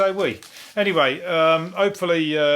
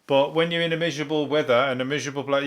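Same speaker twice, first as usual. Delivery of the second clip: usual speaking voice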